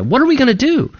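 terrified. Retold or imitated. Servant turns to Elisha, asking in mock terror. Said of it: "he's terrified".

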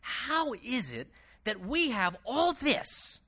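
slow, drawn-out puzzled wondering; mock bafflement. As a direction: act out someone stumped, stretching the words. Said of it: "they scratch their head saying".

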